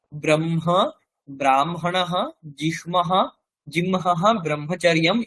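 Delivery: নিচু স্বরে, ম আগে; m before h. Shown as a demonstration. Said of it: "ময়ের আগের উচ্চারণটাই আমরা শুনতে পাই".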